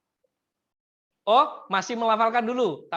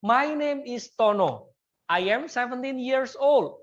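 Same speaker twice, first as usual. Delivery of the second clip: slow, higher, louder English model line; slow model sentence; 5 semitones higher, 4 dB louder, much slower than usual. Shown as a demonstration. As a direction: say it slowly for the class to copy. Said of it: "Contohnya begini Bapak Ibu".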